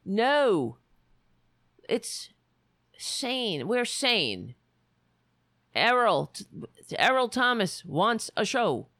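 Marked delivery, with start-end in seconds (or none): none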